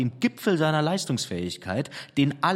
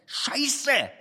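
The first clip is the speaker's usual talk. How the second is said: much higher cry of frustration; sudden outburst; 13 semitones higher than usual. Acted. Painter cursing at his canvas, high sudden cry. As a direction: burst out with a high curse. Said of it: "Plötzlich schrie er".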